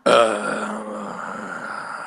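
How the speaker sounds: low, drawn-out groan; long dismayed groan. Acted. Groans at the chewed tree.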